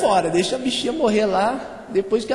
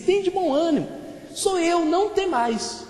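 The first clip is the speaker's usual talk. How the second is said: raised, high-pitched scripture quote; reassuring proclamation; 8 semitones higher than usual. A narrator's reading. Jesus reassures frightened disciples on the water, proclaimed reassurance. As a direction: proclaim it high, slowing at the end.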